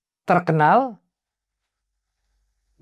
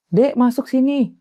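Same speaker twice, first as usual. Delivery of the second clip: calling in; 10 semitones higher than usual. Retold or imitated. Host calls them inside.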